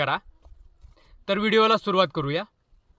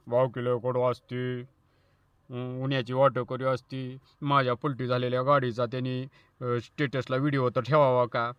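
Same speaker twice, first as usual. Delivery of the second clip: mock sulky grumble; 6 semitones lower, 4 dB quieter than usual. Sulking truck owner complains.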